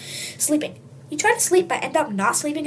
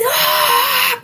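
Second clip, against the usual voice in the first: very high, loud shriek; scream; 9 dB louder than usual. Acted. Kid tasting chili soda, high shriek.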